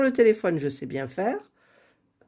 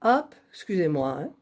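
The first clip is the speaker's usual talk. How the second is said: normal talking voice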